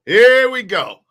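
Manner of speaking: loud, hyped-up "Here we go". Shown as a demonstration. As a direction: shout "Here" like an announcer.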